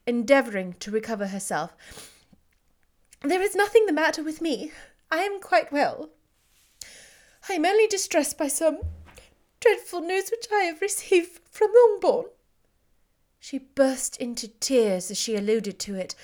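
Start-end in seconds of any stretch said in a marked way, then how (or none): 3.2-12.3 higher, strained denial of distress. Acted. Elizabeth to Darcy, insisting she is well, distressed and rising. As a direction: force out that she is fine, voice rising. Distressed heroine denying her state.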